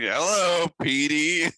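high, drawn-out villain greeting; Willem Dafoe impression. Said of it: "Willem Dafoe".

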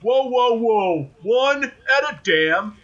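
halting cop cutting in, slow drawn-out finish; acting out Jim Hopper. Cop stopping everyone mid-explanation, acted halting outburst. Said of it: "he's gonna be like".

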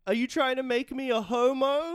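high, loud mock-outraged quote; quoted film line. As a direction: act out an indignant movie line. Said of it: "He said".

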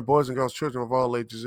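booming ringmaster-style opener; grand entrance announcement. Acted. Podcast opening announcer greeting.

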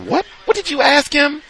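very high, loud squeal of disbelief; mock shocked outcry. Bystander catching a sly question, high mock outrage. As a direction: squawk it in put-on outrage.

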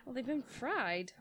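slow, soft, vacant voice; dazed patient voice. Retold or imitated. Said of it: "That's a pretty good impression".

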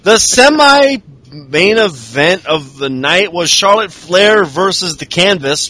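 usual speaking voice

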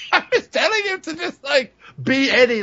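breaking into laughter mid-sentence; laughing. Real, not acted. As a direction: laugh while getting the words out. Laughing at his own story.

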